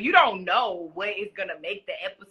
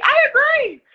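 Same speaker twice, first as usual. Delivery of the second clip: much higher, loud I agree; loud high delighted agreement; emphatic agreement; 12 dB louder than usual. Real, not acted. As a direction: own the compliment proudly. Agreeing with flattering remark.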